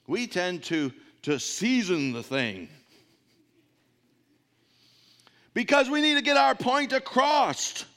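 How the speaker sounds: loud, high preaching voice. Acted. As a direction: preach it out to the congregation. Preaching at full force.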